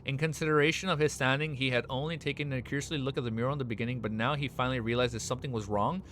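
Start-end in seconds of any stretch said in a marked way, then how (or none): none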